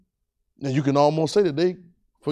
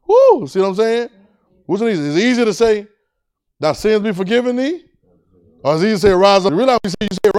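raised, driving preacher's voice; fired-up preaching; 5 semitones higher, 6 dB louder than usual. Real, not acted. Preacher challenging the congregation on which is easier, fired-up preaching. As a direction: build the question into a challenge.

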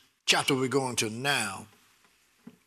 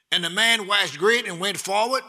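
higher, louder delight; joyful preaching; 6 semitones higher, 4 dB louder than usual. Acted. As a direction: savor the verse with joy.

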